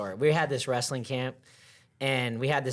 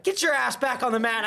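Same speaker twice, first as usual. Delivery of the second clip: stern coach; 9 semitones higher than usual. Retold or imitated. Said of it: "he's like".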